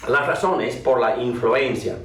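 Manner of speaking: normal talking voice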